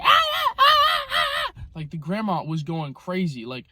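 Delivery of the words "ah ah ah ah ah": very high yelping ah ah ah like a monkey; imitated monkey shrieks. Vlogger imitates the screaming grandma as a monkey, high shrieks. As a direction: shriek like a shot monkey, short and piercing. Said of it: "literally monkeys go like".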